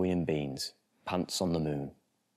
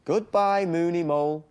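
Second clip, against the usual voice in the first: higher, louder sing-song farewell call; calling goodbye; 10 semitones higher, 4 dB louder, slower than usual. Acted. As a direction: wave off the moon and mole.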